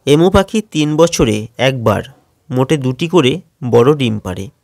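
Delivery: ordinary talk, nothing marked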